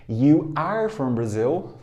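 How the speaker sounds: You are from Brazil, slow with you and are kept apart; teacher modelling the long form You are from Brazil; full form, slow. Shown as a demonstration. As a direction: say You are from Brazil unhurried, each word whole. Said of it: "Forma longa".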